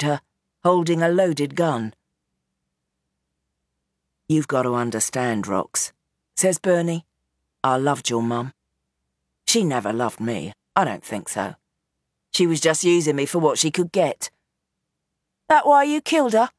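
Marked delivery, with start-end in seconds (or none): 4.3-5.9 lower, weary father pleading his case; old gangster Bernie. Acted. Gangster father explains himself to his daughter, low and weary. 7.6-14.3 lower, weary father pleading his case; old gangster Bernie. Acted. Gangster father explains himself to his daughter, low and weary. 15.5-16.5 higher, cutting question; Roxy, sharp accusation. Acted. Daughter accuses her father of murder, sharp and higher.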